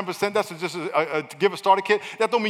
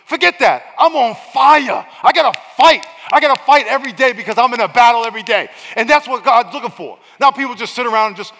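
much louder and higher, shouting the sermon; fiery preaching; 7 semitones higher, 9 dB louder than usual. Acted. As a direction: shout it like a battle cry.

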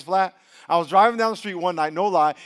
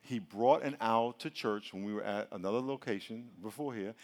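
ordinary delivery

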